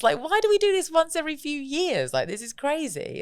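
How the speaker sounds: high-pitched, incredulous squeal of delight; giddy amazement. Acted. Guest acting out the thrill of seeing mates, giddy amazement.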